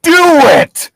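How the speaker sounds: loud yelling do it; shouted command. Retold or imitated. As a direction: bellow it like a motivational screamer. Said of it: "that deep voice said".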